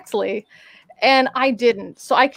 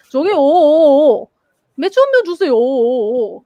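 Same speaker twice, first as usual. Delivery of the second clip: put-on old drunk countryside Korean; 3 semitones higher, 6 dB louder, much faster than usual. Shown as a demonstration. Ordering beer the country way, old drunk man accent. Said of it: "if I'm doing it their way".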